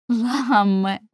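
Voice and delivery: calls his name out loud, imploring; pleading call. Acted. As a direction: cry out his name, begging. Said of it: "казала вона". (благальний вигук)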